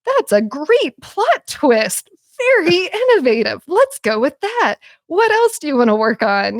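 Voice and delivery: chirpy assistant cheering on an idea; peppy AI voice. Retold or imitated. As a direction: imitate an over-encouraging chatbot. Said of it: "the Chat G P T voice was like".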